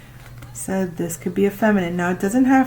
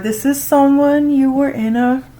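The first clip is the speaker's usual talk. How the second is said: excited; 6 semitones higher, 7 dB louder than usual. Real, not acted. Shocked at tarot reading.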